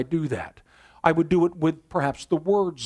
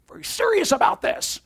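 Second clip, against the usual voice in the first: high, loud, intense burst; louder to show seriousness. Shown as a demonstration. Pastor showing his congregation a serious voice, loud and intense. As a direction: raise the volume to sound dead serious. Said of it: "Maybe even the volume".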